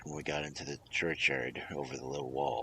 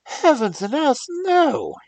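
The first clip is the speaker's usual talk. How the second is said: much higher, much louder outburst; outraged cry; 13 dB louder, slower than usual. A narrator's reading. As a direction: cry it out in a storm of passion. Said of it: "cried Arthur in a storm of passion".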